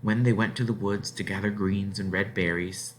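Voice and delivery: ordinary delivery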